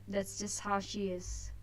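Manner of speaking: ordinary talk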